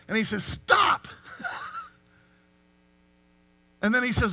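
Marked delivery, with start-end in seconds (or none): 0.4-1.1 angel's cry. Acted. Angel cries out to Abraham. 1.1-1.8 short high laugh, airy and quiet; a light laugh breaks out after the cry; high-pitched laugh. Real, not acted.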